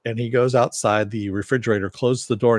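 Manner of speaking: plain reading voice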